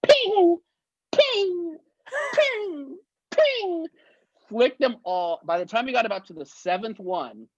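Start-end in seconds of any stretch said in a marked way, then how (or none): 0.0-3.9 high sharp ping sound; imitated cashew flick. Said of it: "he went like this".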